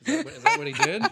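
asking through a laugh; laughing out the question. Real, not acted. Laughing disbelief at Rogers joke.

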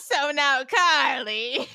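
slow, high camp mimicry; drawn-out, hammed-up camp delivery. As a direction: imitate an extra-camp villain.

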